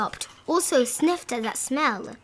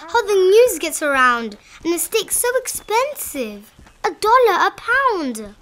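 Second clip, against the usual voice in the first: put-on dismayed boy; 6 semitones higher, 4 dB louder than usual.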